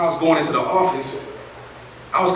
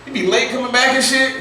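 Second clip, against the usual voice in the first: fast mock whining, higher; whiny complaining; 10 semitones higher than usual. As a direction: complain breathlessly about the cafeteria.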